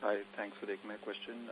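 ordinary delivery

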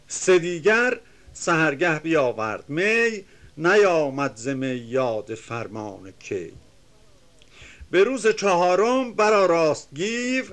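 measured, chanting reading of epic verse; reciting classical Persian verse; poem recitation. Acted. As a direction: declaim the couplets with rhythm.

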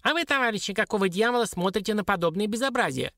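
high, squeaky indignant dwarf; high-pitched appeal to onlookers. A narrator's reading. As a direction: complain in a tiny creature's high voice. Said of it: "обратился карлик".

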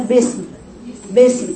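باسم with a lax "be" in place of "bi"; wrong basmala vowel. Shown as a demonstration. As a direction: show the faulty باسم, vowel too open. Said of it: "وليست باسم".

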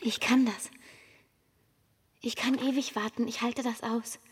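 calm, even first-person narration over music; Ida's inner voice, close and steady; inner monologue. A narrator's reading.

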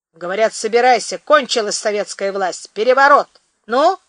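loud, rising alarm; urgent alarm. Acted. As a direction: shout the news to scare him.

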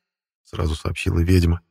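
ordinary delivery, nothing marked

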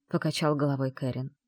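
ordinary delivery

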